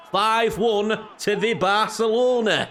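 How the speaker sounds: put-on slow, broad Yorkshire accent; old Yorkshire accent. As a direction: drawl it in old Yorkshire dialect. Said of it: "five one to Barcelona in the old Yorkshire".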